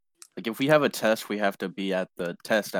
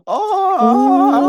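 very high, loud ooh; shrieked oh; 16 dB louder than usual. Real, not acted. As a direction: shriek out a teasing oh. Group reacts to insult.

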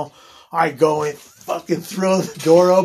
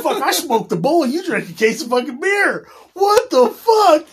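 laughing outrage; 9 semitones higher, 4 dB louder than usual. Retold or imitated. Telling off a drunk friend, laughing and high. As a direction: laugh through a scolding what-the-fuck.

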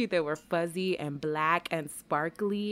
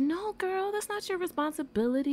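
put-on young sister voice; 12 semitones higher than usual. Sister's voice quoted.

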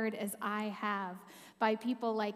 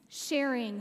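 normal talking voice

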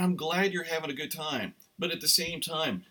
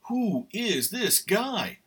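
higher, slow marvelling question; awed disciple; 6 semitones higher, 4 dB louder, much slower than usual. Retold or imitated. Imagined disciple marvelling at Jesus, slow and higher. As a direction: draw it out in wonder. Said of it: "if I was one of the disciples".

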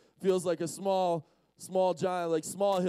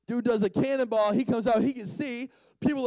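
louder, livelier telling; energetic; 4 semitones higher, 4 dB louder than usual. Real, not acted. Preacher recapping the man's dive, energetic. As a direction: launch into it with punch. Energetic retelling of same line.

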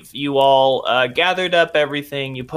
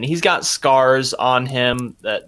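normal talking voice

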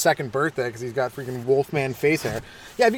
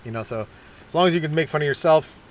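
ordinary talk

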